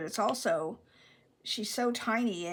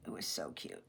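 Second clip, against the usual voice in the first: soft breathy whisper of the repeat; 8 dB quieter than usual. Real, not acted. Same words repeated, then whispered.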